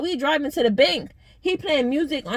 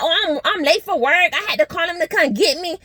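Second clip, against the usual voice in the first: lightly raised mock of the friend; 5 semitones higher than usual. Mocking the friend who claims she's late for work, slightly higher. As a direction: mimic her excuse, a bit higher. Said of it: "She like".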